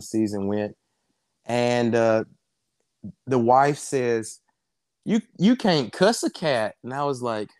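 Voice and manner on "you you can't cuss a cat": mock Southern twang, pitched up; a light imitation of a country wife's saying; quoting the wife's Southern twang. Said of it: "she said it in a Southern twang".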